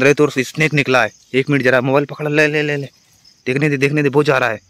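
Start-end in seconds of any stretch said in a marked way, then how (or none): none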